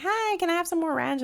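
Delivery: high, sweet imitation of a schoolmate asking for ranch; high mock customer voice. Schoolmate at the drive-through asking for ranch, high mock voice. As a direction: chirp it up like a polite teen customer. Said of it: "And they'd be like".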